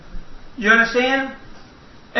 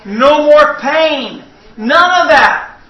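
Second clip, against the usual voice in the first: rising, louder preacher's cry; preaching at full force; 5 semitones higher, 5 dB louder, much slower than usual. Acted. Preacher promising the congregation no more pain, preaching at full force. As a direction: build the promise of heaven to a shout.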